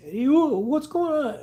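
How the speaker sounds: high, slow, bewildered question; confused and scared. Retold or imitated. Coworker coming out of a seizure, high frightened confusion. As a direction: sound lost and frightened as you wake up. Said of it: "he's got tears in his eyes".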